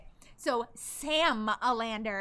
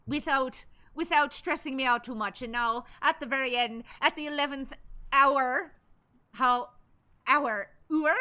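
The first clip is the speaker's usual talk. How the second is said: put-on Irish accent, a bit strained. Streamer sweating through requested accent, Irish accent. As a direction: labour on in a mock Irish accent. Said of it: "the sweat's coming back with the accent".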